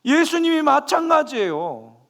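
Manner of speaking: loud, high fervent preaching; impassioned sermon climax, loud and high; full-force preaching. Acted. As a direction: preach it out to the congregation.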